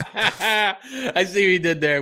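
high, slow words through real laughter; amused by the partner's pun; laughing. Real, not acted.

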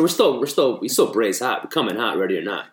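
ordinary talk, nothing marked

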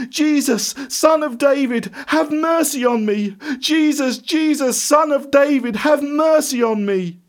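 high-pitched, sustained pleading shout; loud shout. A narrator's reading. Blind beggar begging Jesus for mercy, shouting. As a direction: cry out to be heard over a crowd. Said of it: "in a loud voice he shouted".

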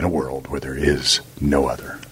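low epic trailer line; dramatic voiceover opener; movie trailer voice. Pretend. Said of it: "that's the movie trailer voice".

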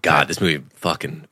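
usual speaking voice

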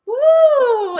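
loud high whoop; excited woo. Real, not acted. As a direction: whoop it out with joy. Cheering guest greets the show.

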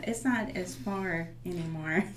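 ordinary delivery

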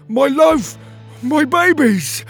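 very high, loud, drawn-out cries; overjoyed reunion. Acted. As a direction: cry out to your long-lost family. Father finds his lost family.

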